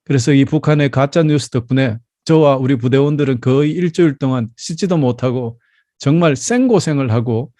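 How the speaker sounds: ordinary delivery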